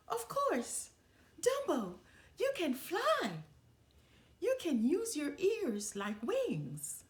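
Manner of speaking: high-pitched squeaky burst of discovery; the idea hits him, bubble over with joy; excited realization. A narrator's reading. Mouse realizing Dumbo can fly, high excited squeal.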